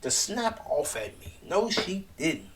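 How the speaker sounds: high, slow, offended disbelief; shocked disbelief. Retold or imitated. Imitating Britt stunned after her friend snaps at her. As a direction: play it stunned and insulted. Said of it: "Britt just looked shocked, like".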